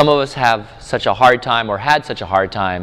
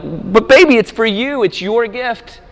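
much higher, gentle pleading; pleading dad; 11 semitones higher than usual. Retold or imitated. Father gently insisting the gift is hers, high tender voice. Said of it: "I'm like".

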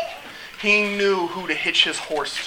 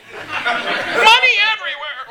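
slow, high-pitched exclamation; hammed-up abandon; 12 semitones higher, slower than usual. Tax collector dropping everything to follow, hammed-up abandon. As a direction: draw it out high, as if flinging it all.

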